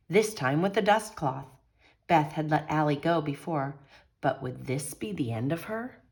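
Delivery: plain reading voice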